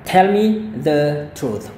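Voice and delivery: "Tell me the truth" drawn out slowly, "Tell" stressed; slow model sentence. Shown as a demonstration. Teacher modelling an example sentence, slowly. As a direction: say it slowly for learners. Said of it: "mungkin ini".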